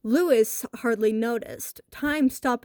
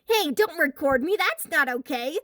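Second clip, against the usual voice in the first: higher, louder protest; protesting kid; 6 semitones higher, 4 dB louder than usual. A narrator's reading. Boy telling the bully filming him to stop, raised protest. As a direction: push back at the camera. Lewis protests being filmed.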